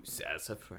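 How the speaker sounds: sassafras drawn out slowly and softly, low; playful emphasis. Shown as a demonstration. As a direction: relish every syllable of sassafras. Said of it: "I wanna emphasize this sound".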